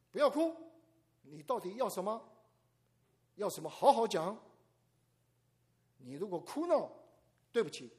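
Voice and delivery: 严厉大声的妈妈，高而响; 敲着尺子喝止孩子; 妈妈训孩子. Retold or imitated. Mother scolding crying child. (学妈妈严厉喝斥孩子)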